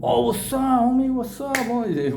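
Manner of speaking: high, eager shout of welcome; light up like you just met a celebrity; excited greeting. Retold or imitated. A gang member greets the YouTuber he recognizes, excited and high. Gangster suddenly friendly and excited.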